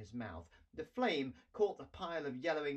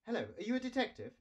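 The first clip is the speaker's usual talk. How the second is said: put-on higher, fast little girl voice; girl's voice; 9 semitones higher, much faster than usual. A narrator's reading. Girl calling the dragon detective, higher eager voice.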